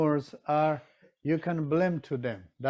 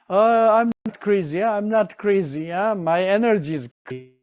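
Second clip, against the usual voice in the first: mock nervous protest; 5 semitones higher, 6 dB louder than usual. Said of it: "You are so nervous".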